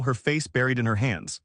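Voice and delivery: plain reading voice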